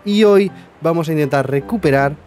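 loud energetic channel intro; youtuber intro. Acted. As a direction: hype up the viewers loudly.

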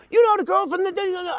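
high, flustered mock stammer; stammering caller. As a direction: trip over the words.

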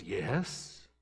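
put-on small, hushed child's hello; a timid kid picking up the phone, hushed; quiet little boy. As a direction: answer softly like a shy little boy. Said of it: "real quiet and soft".

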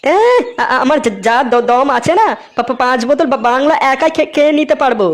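stammers through the brag; stuttering boast. Acted. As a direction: stumble over every word but sound proud. Todla boasts while stuttering. (তোতলাতে তোতলাতে Borah)

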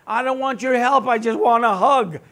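hurting person whining that they only want a hug; whiny plea. Pretend. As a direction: beg for comfort like a sulking kid. Imagined hurting person pleading.

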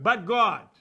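loud, punchy comeback; shouted retort. Shown as a demonstration. Preacher answering the devil's taunts with a shout. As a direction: hit it back hard at the devil. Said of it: "say two words every time the devil will tempt you".

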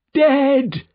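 loud, high cry of grief; bereaved father's outcry; anguished shout. A narrator's reading. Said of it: "shouted the father of the child".

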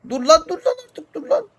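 high, loud panicked yell at the runaway tractor. Real, not acted. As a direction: shriek at it to stop. Tractor won't stop, panicking. (traktöre durması için yüksek sesle panikle bağırıyor)

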